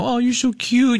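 put-on high cooing baby talk to a dog. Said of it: "you go".

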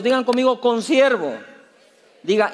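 usual speaking voice

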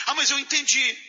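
stubborn retort. Retold or imitated. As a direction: dig in and insist you understood. Acted argument, stubborn final retort.

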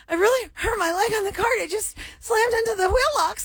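high-pitched, pained quote of herself; high whiny retelling. Retold or imitated. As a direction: whine it like a hurt kid. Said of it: "I was like".

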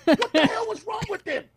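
high gossiping voice, laughing at the end; high mock comedian gossip; catty comedian. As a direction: dish about the guy like a backstage gossip. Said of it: "the other comedians will be like".

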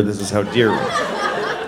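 ordinary talk, nothing marked

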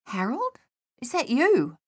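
high, rising startled question; startled query. A narrator's reading. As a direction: check who is there, startled and high-pitched. Startled soldier calls to hidden comrade.

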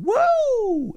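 very high whooping woo; high surfer cheer; joyful whoop. Retold or imitated. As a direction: whoop like a surfer riding a wave.

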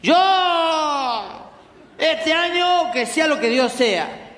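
high, slow tipsy mock speech; drunk rambling. Drunk relative at the party, high slow tipsy rambling. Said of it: "empiezan a decir incoherencia".

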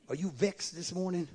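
ordinary talk

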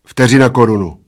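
loud, ready answer; fired off. A narrator's reading. Bald man names the stake he had ready, fired off. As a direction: fire it straight back. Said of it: "vypálil plešatec".

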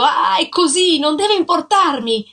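loud, high angry outburst; enraged husband shouting at his wife. A narrator's reading. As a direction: shout it while striking her.

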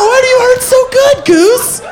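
high, loud mocking quill taunt. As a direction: squeal like a vicious feather. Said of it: "and says".

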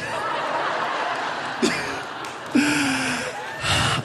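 breathy laugh. Real, not acted. Laughing at own story.

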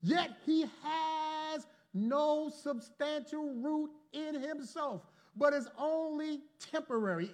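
slow, lifted reading of scripture. Acted. Preacher slowly reading the verse to the congregation. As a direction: read the verse slowly, each word weighed. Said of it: "Look at verse twenty-one".

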